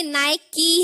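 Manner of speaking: नाइकी slowly with a sounded "kee"; correct pronunciation. Shown as a demonstration. Teacher correcting the brand name, नाइकी as slow "nai-kee". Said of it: "लेकिन ये नाइक नहीं है".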